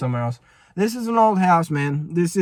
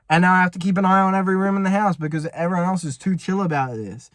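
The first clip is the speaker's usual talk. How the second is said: ordinary talk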